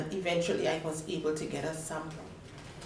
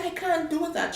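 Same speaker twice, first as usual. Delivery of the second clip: much higher, urgent protest; high-pitched objection; alarmed protest; 11 semitones higher than usual. Retold or imitated. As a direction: push back in disbelief. Protesting nurse's order.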